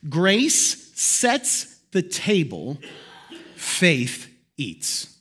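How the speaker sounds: very slow, each phrase drawn out; slow solemn quote. Shown as a demonstration. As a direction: let the saying land word by word. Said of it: "Let me break it down".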